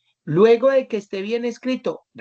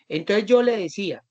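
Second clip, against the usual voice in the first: ordinary talk, nothing marked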